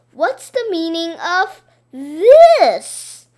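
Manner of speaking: slow, loud accusing boom; mock stern demand. A narrator's reading. Boy throws the teacher's words back, slow and loud. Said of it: "I said".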